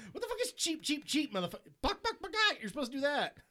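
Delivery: high squeaky taunting voice; mocking Tommy Wiseau. Quoting The Room chicken taunt, squeaky high. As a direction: taunt like a silly schoolyard bully. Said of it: "It's like".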